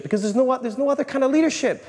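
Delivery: high, loud and wound up; amped up, racing with energy; wired. Real, not acted. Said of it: "I'm so wired right now".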